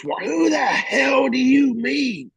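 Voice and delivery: high, drawn-out shout of outrage; imitated screaming. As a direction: scream it slowly, mimicking an enraged chief. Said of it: "just screaming".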